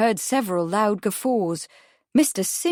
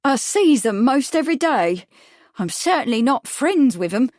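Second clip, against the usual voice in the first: louder, higher, indignant witness voice; bristling; 6 semitones higher, 5 dB louder than usual. A narrator's reading. Witness denying friendship with anarchists, bristling. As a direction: bristle at the suggestion, pitch raised. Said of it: "Missus Battle bristled at this suggestion".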